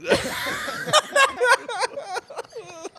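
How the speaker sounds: high breathless laughter; long helpless laugh. Real, not acted. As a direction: crack up completely. Cracking up hard.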